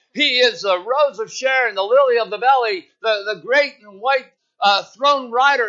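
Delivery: loud, high, grand mock prayer; hamming up a showy long-winded prayer; pompous prayer warrior. Preacher mocking the old saints' showy prayers, loud and grand. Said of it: "they'd go on and on".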